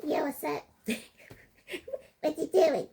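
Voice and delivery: high squeaky guy voice; mock the tough guy with a tiny squeak. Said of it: "And they come like".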